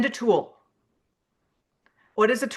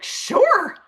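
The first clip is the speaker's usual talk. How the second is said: high, eager yes; a friend's eager high sure; bright "Sure"; 10 semitones higher than usual. Retold or imitated. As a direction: chirp it back brightly. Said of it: "People are like".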